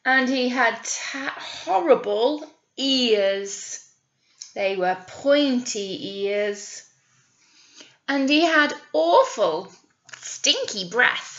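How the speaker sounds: slow, dramatic storyteller voice; drawing children into the scary part; spooky story narration. Acted. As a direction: build the scary troll up slowly. Troll description.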